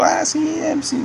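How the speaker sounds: "ah sí" agudo y suave, sin convicción; desganado. Retold or imitated. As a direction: contesta por compromiso, sin creerlo. Responde sin ganas al pastor. (sí desganado y agudo al pastor que le pide orar)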